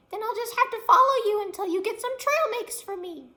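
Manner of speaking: very high and loud squeak; declaring chipmunk. Acted. Chipmunk declares it will follow the girl, high and loud. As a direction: announce it like a tiny boss. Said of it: "the striped rodent declared".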